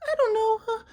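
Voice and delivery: high falsetto with a mock whimper; whiny high put-on voice; mocking his ex. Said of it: "And she's like".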